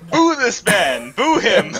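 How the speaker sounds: high, drawn-out heckle; mock booing. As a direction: jeer him like a rowdy crowd. Heckling the joke pitch.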